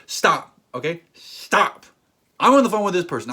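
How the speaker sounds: loud, snapping stop at the interrupter; exasperated shout. Pretend. To a person interrupting a phone call, an exasperated shout.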